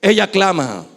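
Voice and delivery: ordinary talk, nothing marked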